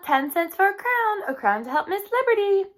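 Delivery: high, loud sales call. Acted. Girls calling to customers, loud and high. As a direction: hawk it like a street seller. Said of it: "they yelled".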